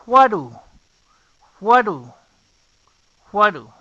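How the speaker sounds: "Juaru" said as "wa-ru" with a tapped r; beginner spelling-pronunciation. Shown as a demonstration. Teacher giving beginners an easy way to say what do. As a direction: say "Juaru" like Spanish wa-ru, clearly. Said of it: "puedes empezar pronunciándolo de esta manera".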